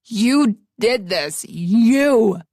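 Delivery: very slow, heavy and menacing; seething rage. Acted. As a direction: grind out the threat. Nick raging.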